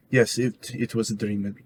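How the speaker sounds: ordinary talk, nothing marked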